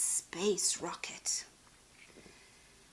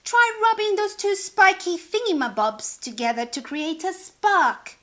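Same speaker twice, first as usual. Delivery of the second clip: higher, louder instructing voice; instructing hippo; 7 semitones higher, 6 dB louder than usual. Acted. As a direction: explain the trick with the spikes. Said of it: "instructed the hippopotamus".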